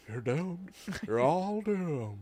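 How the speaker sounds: slow, hushed doom warning; slow ominous raspy drawl. Retold or imitated. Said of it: "The amount of times that he says".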